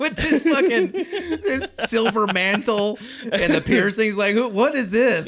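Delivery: high laughing incredulity; cracking up in disbelief. Real, not acted.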